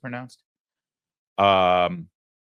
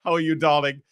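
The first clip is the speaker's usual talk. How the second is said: higher, louder imitation British accent; mock British accent; 7 semitones higher, 5 dB louder than usual. Host mimics TMZ's reporter greeting Oprah, mock British accent. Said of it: "if you've got an accent".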